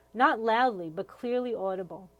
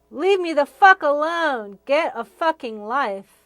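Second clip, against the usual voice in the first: louder and higher furious shout; yelling across the room; 5 semitones higher, 13 dB louder than usual. Retold or imitated. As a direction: snap it at the teacher, loud. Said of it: "stop yelling across the room".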